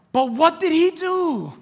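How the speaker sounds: high, slow, loud demanding question; questioning Pharisee. Acted. Pharisee grilling the healed blind man, loud and high. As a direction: press him like a suspicious Pharisee.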